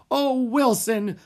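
high, loud, drawn-out wail; despairing cry. A narrator's reading. Theater manager appeals to his assistant, wailing. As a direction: wail to your helper. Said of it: "cried Mister Dewey".

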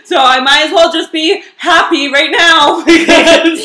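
laughing resignation. Real, not acted. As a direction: giggle through the resignation.

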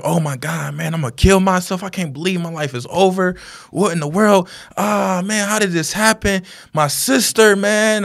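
high, distraught wailing without sobs; retold anguish. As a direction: imitate a young man in despair. Acting the distraught young driver.

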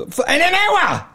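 high-pitched, incredulous repeat of the question; incredulous echo. Retold or imitated. Shopkeeper retelling shock at customer's deadline, incredulous. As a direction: shoot the pitch right up in mock disbelief.

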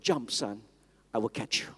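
low, soft, tender promise; father reassuring. Retold or imitated. Father at the table promising his small son, soft tender reassurance. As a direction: gently coax a four-year-old to jump. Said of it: "His father said".

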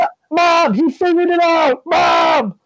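high, loud yell across the house; a kid hollering for Mom; calling for Mom. Pretend. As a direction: shout for Mom like an excited kid.

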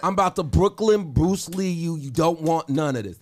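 bad, hammy Brooklyn accent; a shaky put-on Brooklyn accent. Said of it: "That was a horrible Brooklyn accent".

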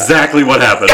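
normal talking voice

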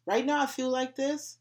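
high, loud, casual call to God; loud casual plea. Retold or imitated. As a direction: belt it out like talking to a buddy. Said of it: "And I specifically say".

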